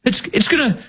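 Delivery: mocking high-pitched interviewer voice; high mock-concerned objection; Margaret Brennan. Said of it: "Margaret Brennan said".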